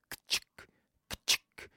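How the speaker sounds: breathy unvoiced ka-chick of a swinging sickle; soft imitated blade sound; whispered sickle swish. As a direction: make the sickle noise under your breath. Imitating sickle cutting grass.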